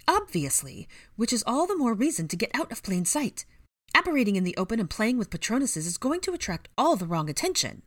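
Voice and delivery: high-pitched, fussy telling-off; scolding. A narrator's reading. Missus Fig tells the group off, scolding. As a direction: lecture them in a high old woman's voice. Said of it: "she scolded".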